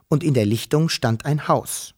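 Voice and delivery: ordinary delivery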